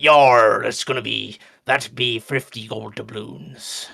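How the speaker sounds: drawn-out mock pirate; loud "yar", then slow pirate talk; weird pirate voice. Shopkeeper quotes Tyler a price, hammy pirate voice. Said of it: "he's gonna do weird voices".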